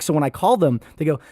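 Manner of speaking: usual speaking voice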